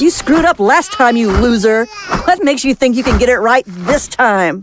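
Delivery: scornful jeering at the monster; taunting. Acted. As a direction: jeer at the monster.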